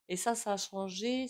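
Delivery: ordinary talk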